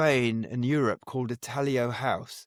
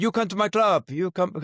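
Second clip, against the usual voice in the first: a bad, hammed-up Spanish accent in broken English, pitched higher; mock Spanish club owner with a clumsy Spanish accent; terrible Spanish accent; 5 semitones higher, 3 dB louder than usual. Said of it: "That was a terrible Spanish accent then".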